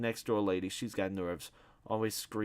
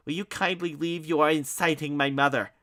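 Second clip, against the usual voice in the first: pompous dismissal; 7 semitones higher, 8 dB louder than usual. Acted. Ignatius ordering guest out.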